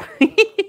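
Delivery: sudden high laugh; squeaky high laugh; high burst of laughter. Real, not acted. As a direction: laugh out at his own joke. Laughs at his own boast.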